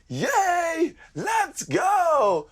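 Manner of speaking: high, loud ad-style whoop; shouted commercial cheer. Retold or imitated. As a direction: shout it out like you're cheering in a TV advert. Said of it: "and shout".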